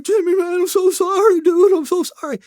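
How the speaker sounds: high, pleading, sorry-sounding friend; tearful apology. Retold or imitated. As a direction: beg forgiveness in a high, cracking voice. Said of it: "He's like".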